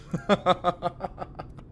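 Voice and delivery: caught-out little laugh; breathy giggle. Acted. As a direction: giggle sheepishly. Admits chocolate excuse.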